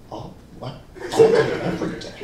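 unvoiced mock-baffled whisper; whispered disbelief. Friend [name] questioning the lie from nearby, whispering.

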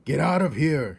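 king shouting at a mouse. A narrator's reading. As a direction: roar it at the mouse. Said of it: "shouted the king".

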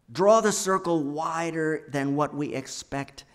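proclaimed refrain. Acted. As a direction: call it out to the whole congregation. Refrain repeated, now proclaimed.

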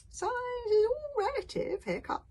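put-on high, slow, drawn-out old man; high-voiced slow reassurance; old grandfather. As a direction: stretch it out like a patient elder.

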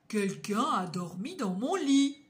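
grosse voix bourrue de papa ours, plus forte. Acted. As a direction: jouer le papa ours mécontent. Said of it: "Et Papa Ours dit". (Papa Ours grogne en rentrant, voix forte et bourrue)

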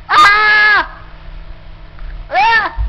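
high, loud panicked screaming. Acted. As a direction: scream in terror. Zombie attack, screaming.

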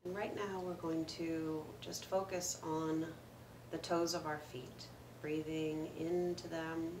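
calm meditation guide with long pauses; guided meditation. Acted. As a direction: gently lead listeners through the breath, unhurried, letting each phrase settle. Leads a breathing meditation.

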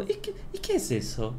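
a hint of a fawning barber, hushed; fingido interés extremo. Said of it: "con una voz demasiado de". (imitando en voz baja un interés exagerado)

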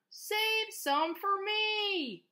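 high, long-drawn singsong plea; farmer's cry. A narrator's reading. Farmer begging animals to leave him apples, drawn-out cry. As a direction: stretch every word high, as a storybook farmer calling out. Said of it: "cried the farmer".

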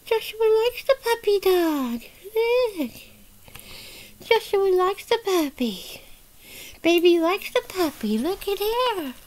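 high singsong doll-play voice; playful baby talk. Pretend. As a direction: coo in a high little voice. Baby talk about puppy.

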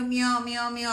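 a hint of a pestering cat, meowing on and on; imitated meowing. Storyteller mimics the cat that never stops crying, light imitated meowing. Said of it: "అరుస్తూనే ఉంటుంది".

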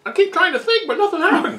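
high cartoon voice; squeaky goofy voice; silly high voice. High put-on voice joke.

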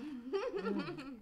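eating a bite while talking; chewing. Real, not acted. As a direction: mouth full while eating. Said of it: "A S M R".

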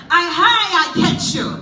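loud, high gotcha shout; spring the trap with glee; the old man catching the thief in his tree; triumphant catch. Acted.

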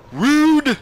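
very high, loud mock-offended squeal; indignant squeal. Gamer reacting to something in the game, high indignant squeal.

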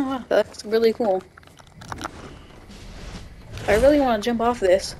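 ordinary talk, nothing marked

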